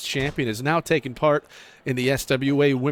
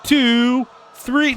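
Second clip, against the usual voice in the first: excited pin count; 8 semitones higher, much slower than usual. Real, not acted. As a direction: count the pin with rising hype. Commentator calls the pin count.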